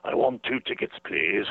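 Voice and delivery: hint at the famous voice, polite; Claude Rains' voice. Retold or imitated. Rains asking for tickets, lightly imitated famous voice. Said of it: "that voice of his that everybody, you know, recognized".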